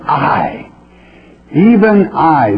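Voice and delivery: weighty stress on each "I", drawn out with a pause between; slow, emphatic "I, even I". Acted. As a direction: land hard on "I", then slowly lift "even I". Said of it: "that's emphatic".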